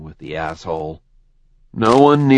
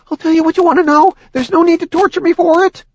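frightened; 5 dB louder, faster than usual. Acted. Demon fears torture.

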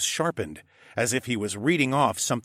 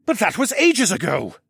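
higher, louder burst of disbelief; exclaiming; 10 semitones higher, 7 dB louder than usual. A narrator's reading. Horace protesting to the group, loud startled exclaim. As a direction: blurt it out, shocked. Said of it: "Horace exclaimed".

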